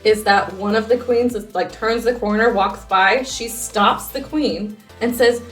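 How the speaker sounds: ordinary talk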